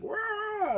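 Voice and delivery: sonic wave cry. Retold or imitated. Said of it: "and it'll be like".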